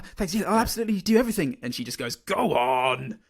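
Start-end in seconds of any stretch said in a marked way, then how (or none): 0.0-1.6 higher, fast, tumbling eager thanks; flustered gushing. Retold or imitated. Friend acting out Holly flustered by praise, higher and fast. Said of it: "flustered, starts stammering". 2.2-3.2 fond teasing. Retold or imitated. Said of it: "in a sort of "You bloody scamp" way".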